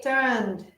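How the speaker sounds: "turned" in one syllable, ending on D; "turned" closed on a clear final D. Shown as a demonstration. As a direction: say "turned" as "turnd", silent E. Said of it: "termina en la D".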